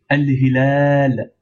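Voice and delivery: al hilal with a long, held second vowel; prolongation. Shown as a demonstration. Teacher showing the long vowel, hilal drawn out. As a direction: stretch the last a of hilal slowly.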